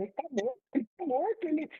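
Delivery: high, soft mock wail; imitated distraught mother. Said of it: "a minha mãe só chorava no quarto".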